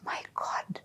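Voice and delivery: whispered gasp of disbelief; shocked whisper. Retold or imitated. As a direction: breathe it out in stunned shock. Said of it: "He was in so much shock".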